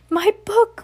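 a witch losing her treasure; panicked witch. Acted. Winifred crying out for her spell book, high panicked witch.

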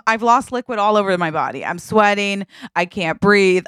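ordinary talk, nothing marked

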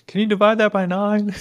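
imitated heckler's shout; background yell. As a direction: call it out high from the crowd. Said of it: "someone yells in the background".

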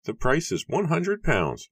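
high-pitched, matter-of-fact bargainer; Matthew announcing flatly. A narrator's reading. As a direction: announce the price coolly in a high register. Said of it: "Matthew announced flatly".

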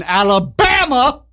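drawn-out, high-pitched movie-quote Alabama; Forrest Gump. Retold or imitated. Host announcing the state, Forrest Gump-style drawl. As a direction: stretch it out and pitch it up. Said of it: "why I say it like that".

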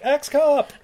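high cheer at the screen; playful high holler; mock excited call. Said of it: "and I'm like".

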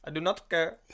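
a light, mock Indian accent. Friend jokingly repeats the nihilist line in Indian accent. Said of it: "I don't know why I went Indian".

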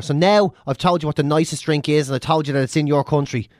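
ordinary talk, nothing marked

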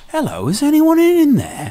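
high Scouse-ish impression of a caller at the door. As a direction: call into the house like a cheery Liverpudlian. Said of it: "the way he talks like [name]".

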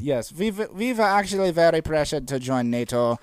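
earnest mock German explaining; mock German accent. Mock German excusing the past, comic German accent. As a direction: justify it in a put-on German accent. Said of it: "in the German accent".

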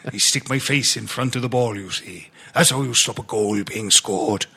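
a mild Welsh footballer voice; Welsh accent. Retold or imitated. Doing James Collins for his podcast mates in a light Welsh accent. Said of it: "I can only really do a Welsh accent if I put the words "you see".

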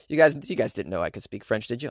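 normal talking voice

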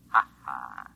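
low, smug chuckle over the phone; sly little ha ha; knowing laugh. Acted. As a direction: laugh like you know a secret. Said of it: "What do you mean, ha, ha?".

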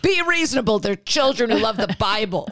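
high, mock-reasoning father; dad voice. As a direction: imitate a dad pleading for reason. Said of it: "And the father's like".

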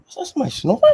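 high-pitched disbelief, comic; incredulous retold question. Telling of walking in on a snorer, comically incredulous. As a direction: act out baffled discovery, pitch way up. Acted puzzlement at the snoring.

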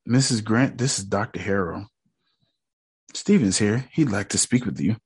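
quiet, low, soft doctor. Retold or imitated. Quoting the doctor calling the mom, softly. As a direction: murmur it like a careful doctor.

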